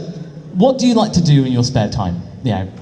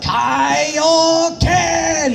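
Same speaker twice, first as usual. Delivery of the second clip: mock Kaioken shout; 14 semitones higher than usual. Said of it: "like, bam".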